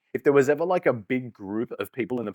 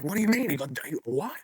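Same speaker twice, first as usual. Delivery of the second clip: incredulous; 7 semitones higher than usual. Retold or imitated. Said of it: "I was like".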